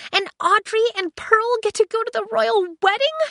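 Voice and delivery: high, loud indignant android; high loud protest; outraged ship AI. Acted. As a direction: wail it as an offended AI. Aiko indignant.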